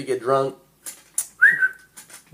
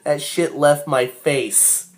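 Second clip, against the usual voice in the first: usual speaking voice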